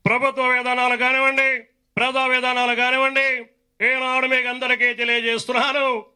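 high, loud political speech; Chandrababu Naidu. Acted. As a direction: declaim on one high note, drawing out line ends. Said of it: "ఇంకొంచెం స్పీడ్గా మాట్లాడితే".

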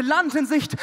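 loud, high sailor's cry; shouting land ahoy while riding the swordfish; bellowing. Retold or imitated. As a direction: bellow it out to the horizon. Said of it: "brüllte".

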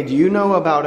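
ordinary talk, nothing marked

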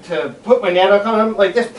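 plain reading voice

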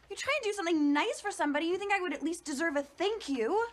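high, loud, aggrieved complaint; indignant. Acted. Daughter wants thanks for ordering milk, indignant. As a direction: complain loudly, hurt and affronted. Daughter feels unthanked in quarrel.

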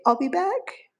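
squeaky high I'll be back to teach the bird; high coaxing demo for the bird; high parrot voice. Shown as a demonstration. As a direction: sound like the parrot saying it. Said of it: "Can you say".

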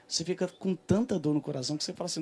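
ordinary talk

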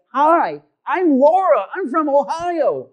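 loud, high, giddy introduction. Retold or imitated. Woman greets a stranger in line, giddy. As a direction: gush it out, drawing out the last part. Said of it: "She was, like, all giddy and stuff".

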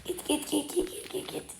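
voiceless whisper, lost voice; barely any voice left, breathy urging. Real, not acted. Urging character on quietly.

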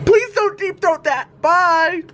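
high pleading voice to the bird; mock pleading. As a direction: beg the seagull in a put-on voice. Talking to the seagull.

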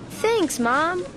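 ordinary delivery, nothing marked

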